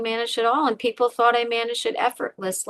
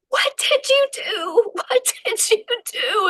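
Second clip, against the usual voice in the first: much higher, slow, disbelieving cry; shocked self-reproach; 12 semitones higher than usual. Retold or imitated. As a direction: ask yourself in horror what you've done. Shocked self-reproach after crash.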